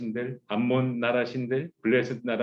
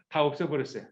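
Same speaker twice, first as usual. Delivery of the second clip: ordinary talk, nothing marked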